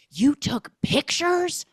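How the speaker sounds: high, loud, slow outraged question; mock outrage. Mother confronting Ryan in the kitchen, slow mock outrage. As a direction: demand it, appalled.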